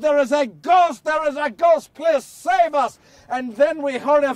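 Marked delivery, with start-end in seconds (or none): 0.0-3.0 loud, high cry of panic; panicked shouting in the storm; terrified shout. Acted. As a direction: scream for rescue from a ghost. Disciples crying out in the storm.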